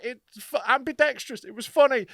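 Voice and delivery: squeaky excuse through laughter; high mock defensive reply. His own retold reply.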